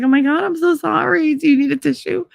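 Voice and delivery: high-pitched, gushing fake apology; mock apologetic. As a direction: imitate an over-sorry friend in a high voice. Imitates her apologizing after stabbing.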